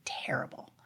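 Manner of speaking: hushed, breath-only word; soft confession after a pause; remorseful. Retold or imitated. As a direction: drop to a near whisper, weighed down by guilt. Confessing how bad she felt.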